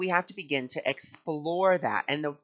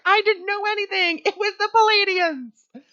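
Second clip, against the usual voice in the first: gushing reply; 7 dB louder than usual. Retold or imitated. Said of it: "And I was like".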